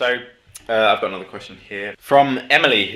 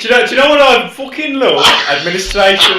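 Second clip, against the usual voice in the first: loud, high, hammed-up relish; mock admin enthusiast; 12 semitones higher, 11 dB louder than usual. Office worker declaring love of admin, mock-fervent. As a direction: belt out a mock passion for paperwork.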